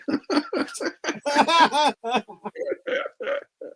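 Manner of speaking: breathless, airless laugh high in the throat; silent wheezing laugh. Real, not acted. Laughing at a coworker's orgy story, wheezing silently. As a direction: laugh with no voice behind it.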